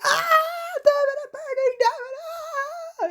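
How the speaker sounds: extremely high hammed-up shrieks of agony; screaming, dying on fire. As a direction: scream the words as if burning alive. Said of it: "trying to do the voice when he was, like, dying on fire".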